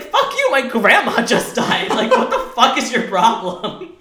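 high, loud, drawn-out mock rant; going off. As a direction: yell it slowly, half laughing. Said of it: "went off on her".

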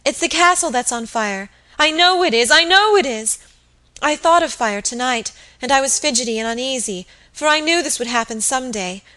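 high, agitated voice building to a wail; distracted distress. A narrator's reading. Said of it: "sobbed the girl distractedly".

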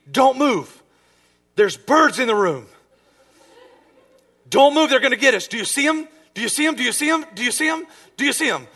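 high, loud, urgent alarm; acted-out panic, rattling off urgent questions; panicked warning. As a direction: sound the alarm in a high, frantic voice. Sleep-talker warns of imagined birds.

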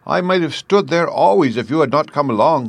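high-pitched, polite tin-man voice; grateful Tin Man. A narrator's reading. Tin Man figure in story.

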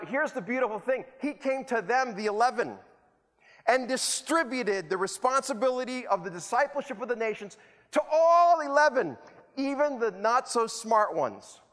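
normal talking voice

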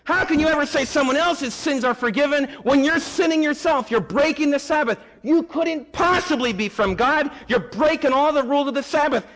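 high-pitched, indignant protest; mock outrage at a blasphemer; outraged Pharisees. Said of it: "would just scream bloody murder".

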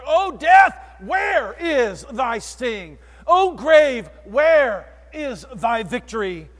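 triumphant proclamation. Acted. Preacher crying out to death, loud and slow. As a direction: proclaim it slowly, defiant.

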